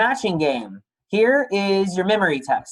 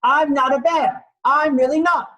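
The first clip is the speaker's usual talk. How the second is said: mock shout; 9 semitones higher, 3 dB louder than usual. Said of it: "I'm sorry if I shout".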